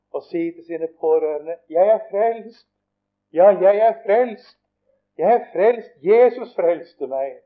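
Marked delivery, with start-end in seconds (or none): 1.7-7.4 loud, high testimony of salvation; jubilant praise. Retold or imitated. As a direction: proclaim it to everyone, high and emphatic. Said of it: "å prise Jesus". (jublende, høylytt vitnesbyrd)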